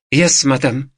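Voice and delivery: put-on mechanical maid's reply, Eastern European accent. A narrator's reading. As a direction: repeat it by rote as a servant. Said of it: "repeated mechanically in the same tone".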